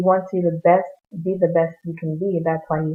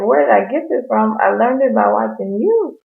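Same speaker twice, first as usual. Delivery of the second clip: imitating the boy; 5 semitones higher, 4 dB louder than usual. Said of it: "the boy is like".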